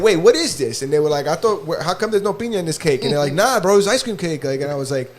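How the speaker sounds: ordinary talk, nothing marked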